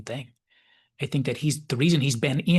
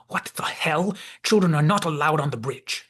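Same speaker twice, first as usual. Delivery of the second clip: sharp, higher, louder furious yell; furious outburst; 3 semitones higher, 4 dB louder than usual. Retold or imitated. Picard finding a child on his bridge, furious yell. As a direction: bark it out at the kid on the bridge. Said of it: "Picard is immediately furious".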